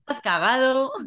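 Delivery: Spaniard trying a Mexican accent; Mexican accent attempt. Shown as a demonstration. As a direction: have a go at sounding Mexican. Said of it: "dilo con el acento mexicano". (intento de acento mexicano)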